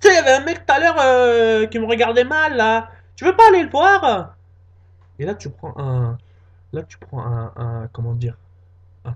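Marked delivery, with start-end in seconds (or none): 0.0-4.4 put-on high, loud girlfriend pushing; girlfriend's voice. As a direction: egg him on in a high voice.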